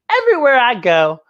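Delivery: ordinary delivery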